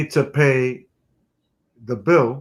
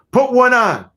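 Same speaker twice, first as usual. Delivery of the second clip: higher, louder Caco command; Caco's voice; 9 semitones higher, 5 dB louder than usual. Retold or imitated. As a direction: order the kid as Caco. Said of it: "'Cause he talked like this".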